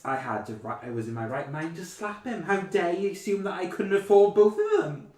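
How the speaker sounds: high, loud, affronted posh lady; posh outrage. Retold or imitated. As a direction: huff it out like she was insulted. Indignant rich lady quoted.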